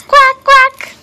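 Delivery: high, loud squawky quack; duck quack. Pretend. Mother showing baby what the duck says, high loud quack. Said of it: "The duck says".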